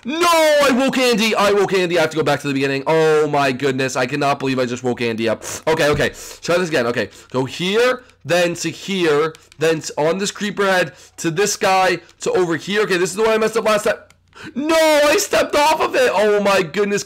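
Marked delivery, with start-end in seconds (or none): 0.1-1.4 dismayed yell. Real, not acted. Player waking Andy and losing progress, dismayed yell. Woke Andy, must restart the game. 14.6-16.1 high, drawn-out wail of failure; dismayed yell. Real, not acted. Player stepping off the block, dismayed yell. Fell off during the jump course.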